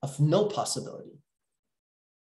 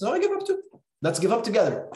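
much higher, bright mock surrender; higher, teasing "let's quit together"; playful give-up; 7 semitones higher than usual. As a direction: tease them into laughing. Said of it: "we're partners in the coaching session".

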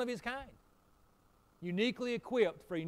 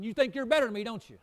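higher, louder mock resentful jab; imitating angry accuser; 7 semitones higher, 11 dB louder than usual. Pastor imitating a bitter neighbour, mock angry retort. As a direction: throw it back like a bitter neighbour. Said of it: "they start getting angry and bitter".

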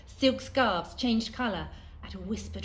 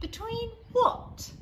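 put-on curious child; 4 dB louder, slower than usual. A narrator's reading. Leon asks the strange boy.

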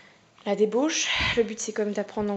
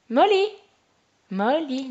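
put-on Irish accent; 5 semitones higher, 12 dB louder, slower than usual. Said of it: "peut-être que je fais pas ce accent irlandais".

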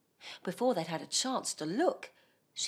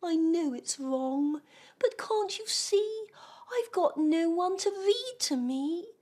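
sad little creature; 10 semitones higher, 4 dB louder than usual. Acted. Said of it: "softly he began to cry".

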